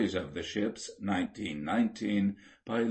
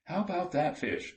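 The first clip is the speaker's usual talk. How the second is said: higher, lightly raised call; mate's shout; 8 semitones higher than usual. A narrator's reading. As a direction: call out across the dark deck. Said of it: "shouted the mate".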